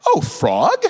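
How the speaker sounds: high, questioning lilt of feigned innocence; innocently curious parent. Acted. Parent redirecting a swearing child, innocently curious. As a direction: play it bright-eyed and puzzled, voice leaping up. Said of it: "what you do is I would say".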